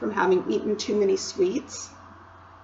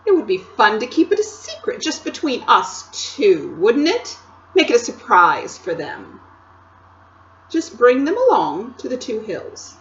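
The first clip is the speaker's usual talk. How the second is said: louder, higher sly queen, wheedling; coaxing Queen; 3 semitones higher, 6 dB louder than usual. Acted. The Queen persuading Edmund, sly and wheedling. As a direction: purr a secret plan to a boy.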